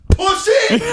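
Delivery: dad yelling to break the silence in the restaurant, a loud mock scream; imitating a scream. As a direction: belt it out across a silent room. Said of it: "Screams it. like, that loud".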